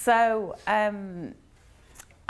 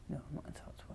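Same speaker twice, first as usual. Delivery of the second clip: whisper; 4 semitones lower, 18 dB quieter, much faster than usual. Retold or imitated. Shy child refusing.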